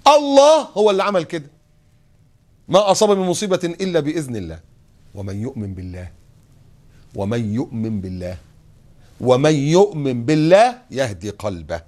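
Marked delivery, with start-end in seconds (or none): 2.7-11.8 Quran recitation. Acted. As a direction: recite the verse with reverence. (تلاوة متأنية خاشعة)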